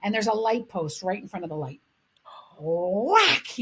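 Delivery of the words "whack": loud imitated whack; explosive impact. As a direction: hit it like the blow landing. Imitating the blow on the post.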